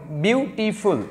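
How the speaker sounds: বিউটিফুল broken into separate syllables. Shown as a demonstration. Teacher showing বিউটিফুল has many syllables, said syllable by syllable. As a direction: sound out বিউটিফুল one syllable at a time. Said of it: "অনেকগুলো syllable আছে".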